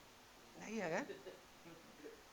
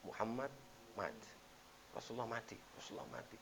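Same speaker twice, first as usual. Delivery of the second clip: hushed grief; 6 semitones lower, 9 dB quieter than usual. Retold or imitated. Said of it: "Maka orang bilang".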